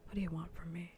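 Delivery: ordinary talk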